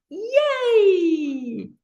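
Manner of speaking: long, high squealed yay; childlike cheer. Shown as a demonstration. Teacher showing playful activity to class, childlike cheer. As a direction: cheer it out, silly and drawn out. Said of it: "A little bit childlike, silly, and fun".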